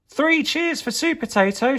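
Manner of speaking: very high, rising "Three cheers"; cheering shout. Acted. Broccoli cheering the hero, high excited shout. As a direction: cheer him on. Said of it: "shouted Broccoli".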